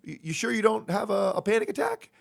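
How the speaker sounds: high-pitched mock paramedic, disbelieving; doubting paramedic. Paramedic questioning the patient, squeaky mock doubt. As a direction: pitch it way up on attack, skeptical. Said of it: "And they say".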